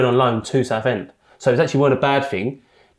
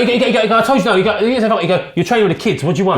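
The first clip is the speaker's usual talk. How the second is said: raised, higher re-enacted brush-off; retold angry dismissal; 8 semitones higher, 4 dB louder, faster than usual. Manager dismissing returning player to the kids, angry. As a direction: send him away, voice up.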